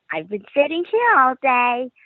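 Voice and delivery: lightly voiced ghost child; little girl ghost. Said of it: "which said".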